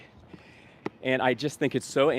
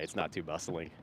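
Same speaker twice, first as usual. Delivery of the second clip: softer, tempered take; toned-down restatement; 4 semitones lower, 9 dB quieter than usual. Shown as a demonstration. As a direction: take it down a notch. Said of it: "Temper that statement".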